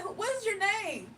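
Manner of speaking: asking high and pointed; incredulous high question. Retold or imitated.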